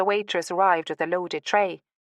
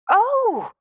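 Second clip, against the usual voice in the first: very high, loud "oh" of realisation; startled exclamation; 5 dB louder than usual. A narrator's reading. Woman realising what the name means, startled exclamation. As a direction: exclaim as it suddenly dawns on you. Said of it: "she exclaimed".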